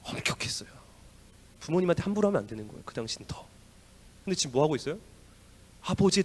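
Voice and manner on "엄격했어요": whispered 엄격했어요 with no voice; 엄격했어요 whispered, unvoiced and hushed. Shown as a demonstration. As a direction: breathe 엄격했어요 as a grave whisper. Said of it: "사형입니다".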